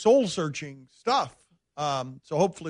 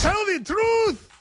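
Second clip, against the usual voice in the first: high, slow mock film accent; Will Smith Concussion accent; slower than usual. Radio host parroting the film clip, mock Concussion accent. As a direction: draw it out high in a hammed-up movie accent. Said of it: "Will Smith's terrible accent in Concussion".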